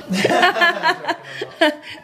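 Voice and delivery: high laugh. Real, not acted. Laugh after van joke.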